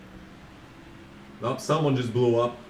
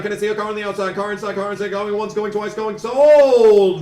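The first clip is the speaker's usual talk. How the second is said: higher auction call, loud final sold; auctioneer; 5 semitones higher, 7 dB louder than usual. Retold or imitated. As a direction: build to a loud closing sale. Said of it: "he sounds like a fucking auctioneer".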